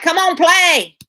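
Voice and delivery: high loud shout of impatience; mock impatient shout from other players; impatient urging. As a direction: yell at them to hurry up and play. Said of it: "People be like".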